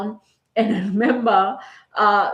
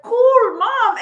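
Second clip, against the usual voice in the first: put-on much higher, louder, delighted kid voice; thrilled kid; 6 dB louder than usual. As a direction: squeal it like an excited child. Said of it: "and they were like".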